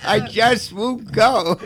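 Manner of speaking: laughing. Real, not acted. Joking about never dying.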